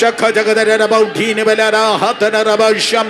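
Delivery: stream of chanted syllables; fervent ecstatic prayer, intoned; praying in tongues. Real, not acted. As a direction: pour out syllables in rhythmic chant. Prays in tongues.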